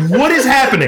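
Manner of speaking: high-pitched retold bewilderment, half laughing; laughing, high baffled exclamation; baffled disbelief. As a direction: act out incredulous astonishment, pitch way up. Reenacts bewildered reaction in story.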